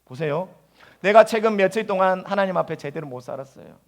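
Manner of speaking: ordinary talk, nothing marked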